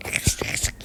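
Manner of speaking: urgent whisper. Real, not acted. Girl hiding from mum, urgent whisper. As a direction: hiss it to hide fast.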